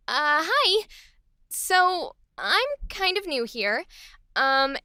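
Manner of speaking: hesitant, friendly greeting with small pauses; happy newcomer. Acted. New worker asks to join coworkers for lunch, happy. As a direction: introduce yourself shyly, hoping to be included. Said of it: "Let's try it for Happy".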